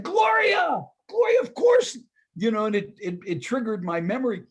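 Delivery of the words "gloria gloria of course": much higher and louder, it all comes back; delighted recognition. Retold or imitated. On the phone, he suddenly remembers the caller, delighted. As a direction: burst out as the memory clicks.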